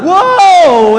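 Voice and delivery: high, loud startled "Whoa"; surprised whoa. Acted. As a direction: jump at the sight. Moses sees leprous hand.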